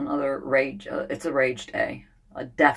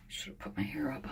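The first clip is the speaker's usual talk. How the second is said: whisper; 14 dB quieter, faster than usual. Real, not acted. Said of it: "unvoiced asides".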